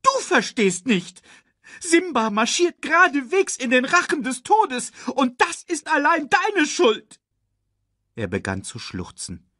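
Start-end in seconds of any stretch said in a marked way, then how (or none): 0.0-7.2 very high, frantic accusation; protesting. A narrator's reading. Timon blames Nala for Simba's danger, protesting frantically. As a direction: rant at a frantic high pitch. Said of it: "protestierte Timon".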